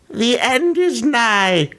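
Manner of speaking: high, slow, eerie drawl; menacing whisper-like creep; creepy voice. Pretend. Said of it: "to do my creepy voice".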